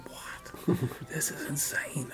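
whispered disbelief; breath-only, astonished whisper; stunned whisper. Retold or imitated. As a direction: whisper it as if you can't believe it. Retelling stunned reaction to praise.

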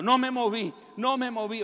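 high, loud tearful cry to his father; a little boy sobbing out his relief; crying child. Retold or imitated. Boy crying to his father after the battle.

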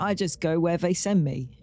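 London accent impression; imitated London accent; Michael Caine. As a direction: do a cockney-style British film star. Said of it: "No, that's Michael Caine".

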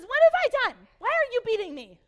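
loud, high-pitched donkey outburst; high shouted complaint, far above normal speech; shouted protest. Acted. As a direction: yell it as an indignant, hammed-up donkey. Acting out the talking donkey's protest.

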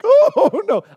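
very high, loud comic wail; mock dread. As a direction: wail it in hammed-up dismay. Boy dreads the doorbell.

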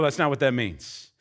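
ordinary talk, nothing marked

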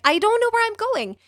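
high, fast protest; exasperated protest. Retold or imitated. Rider to Uber driver kicking her out, exasperated protest.

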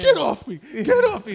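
high, laughing mock yell of panic; Will Smith overreacting. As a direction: ham up the frantic shove-off.

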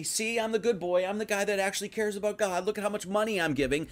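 high, smug self-praise; boastful show-off. Retold or imitated. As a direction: preen like a pious show-off. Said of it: "so that everybody could see".

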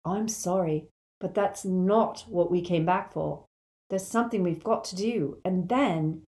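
usual speaking voice

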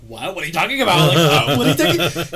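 loud, high, incredulous what are you talking about; shocked disbelief. Retold or imitated. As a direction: act stunned and outraged at the accusation. Shocked denial retold to sister.

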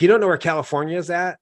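high, fast retold disbelief; mock incredulity; incredulous boss. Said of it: "He's like".

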